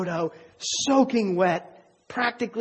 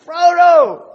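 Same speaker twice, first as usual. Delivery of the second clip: calling out a name, high and much louder; panicked shout; 10 semitones higher, 13 dB louder than usual. Retold or imitated. Sam calling out to Frodo from the riverbank, panicked shout. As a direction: yell his friend's name in panic. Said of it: "he's panic-stricken".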